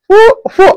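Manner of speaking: "ফু, ফু" puffed high, lips kept from touching; failed "প" attempt. Shown as a demonstration. Teacher showing that প fails without lips, puffed high "ফু, ফু". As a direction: try "প" with lips held apart, getting only a high "ফু, ফু". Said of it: "আমার ঠোঁট আমি ধরে রাখলাম".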